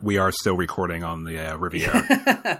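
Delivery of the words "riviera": high, slow mock-grand Riviera; mock grand aside. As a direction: draw out Riviera for a joke. Joking aside to listeners.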